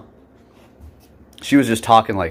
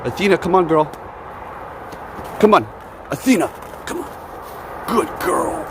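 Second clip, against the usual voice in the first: higher, sing-song call to the dog; 8 semitones higher than usual. Real, not acted. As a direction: coax the dog over in a sweet high voice. Calling her dog outside.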